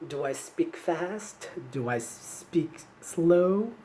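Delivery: drawn-out, very slow questions; slow demonstration. Shown as a demonstration. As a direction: stretch out each word for the students. Teacher demonstrates her speaking speed.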